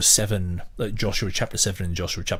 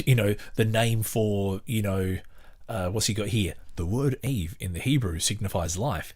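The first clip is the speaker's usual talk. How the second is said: ordinary talk, nothing marked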